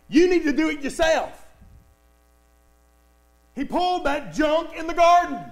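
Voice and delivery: ordinary talk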